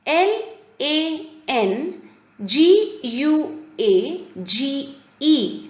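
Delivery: slow, separated letters; drawn-out classroom spelling. Shown as a demonstration. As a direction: spell it for pupils, one letter at a time. Teacher spells the underlined word.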